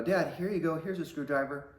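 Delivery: put-on a small boy handing over a screwdriver; eager little kid. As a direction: play yourself at five, proud to help. Five-year-old voiced offering help.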